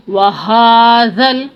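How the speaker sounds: ordinary talk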